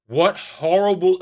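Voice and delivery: ordinary delivery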